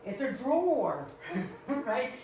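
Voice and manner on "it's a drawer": "drawer" stretched with a strong R; standard pronunciation. Shown as a demonstration. Mocking how others say it, over-pronounced "drawer". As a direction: exaggerate the final R of "drawer". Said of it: "like D R O O R".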